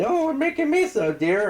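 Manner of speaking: bad high Kiwi accent; a failed try at a Kiwi voice. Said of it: "I can't do a Kiwi accent".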